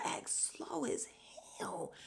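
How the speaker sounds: slow hushed whisper; a secret little jab; whispered aside. Real, not acted. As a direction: lean in and whisper the insult. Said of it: "aside to viewers".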